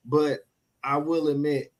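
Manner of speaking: usual speaking voice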